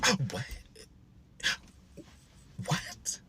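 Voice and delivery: soft, breathless whispered what; stunned disbelief. Retold or imitated. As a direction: barely get the words out, floored. Stunned reaction to gossip.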